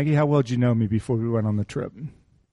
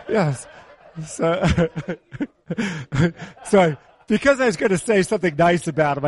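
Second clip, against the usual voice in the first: laughing; 4 semitones higher than usual. Real, not acted. Laughing at own joke.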